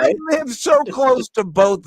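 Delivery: high, slow, insistent complaint; comic worked-up protest; exasperated rant. Pretend. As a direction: plead your case up high, stretching the words. Comic rant about accents.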